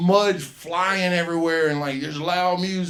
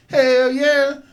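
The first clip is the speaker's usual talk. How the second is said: higher and louder, eager; eager shout; 10 semitones higher, 9 dB louder than usual. Retold or imitated. Comic quoting friend eagerly agreeing, high and loud. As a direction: jump at the offer gladly. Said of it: "he was like".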